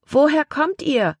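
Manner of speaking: put-on high, child-like call; a small girl shouting up to the boat. A narrator's reading. Girl on the shore asking the children where they come from, calling high. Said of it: "rief ein Mädchen".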